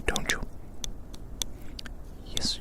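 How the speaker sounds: ordinary talk, nothing marked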